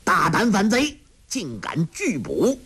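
mild mock scolding. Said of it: "那武官骂道".

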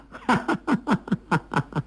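long high staccato cackle; gloating laugh. A narrator's reading. Son delighted with his own scheme, high gloating laugh. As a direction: burst into a drawn-out scornful laugh, falling away. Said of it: "完颜康笑道".

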